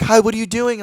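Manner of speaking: high, loud, bubbly question; imitated excited greeting. Friends calling from the car, imitated excitement.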